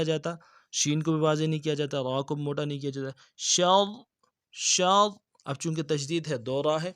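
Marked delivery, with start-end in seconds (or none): 3.4-5.1 شر with a heavy doubled ra, louder; stressed shar. Shown as a demonstration. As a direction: press the ra of شر hard. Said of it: "زور بھی دینا ہے". (شر میں را پر تشدید کا زور)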